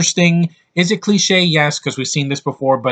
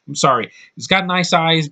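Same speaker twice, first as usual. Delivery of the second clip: ordinary delivery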